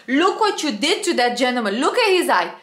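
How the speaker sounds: scolding. Retold or imitated. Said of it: "I was like".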